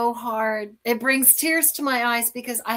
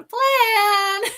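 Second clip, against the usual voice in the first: laughing; 12 semitones higher, 5 dB louder than usual. Real, not acted. Laughs with emotion at God's plan.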